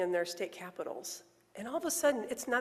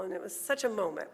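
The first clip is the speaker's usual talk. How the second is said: ordinary talk, nothing marked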